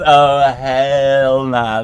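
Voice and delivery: slow, stretched-out mock dismay; drawn-out refusal. Real, not acted. Streamer learning there is voice chat, slow and stretched in mock dismay. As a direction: drag it out in disbelief.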